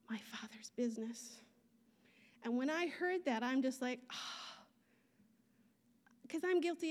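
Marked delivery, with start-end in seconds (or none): 0.1-1.4 hushed whisper on the last words. Retold or imitated. Quoting the Lord's word. 4.1-4.7 soft unvoiced gasp. Real, not acted. Reaction to hearing the word.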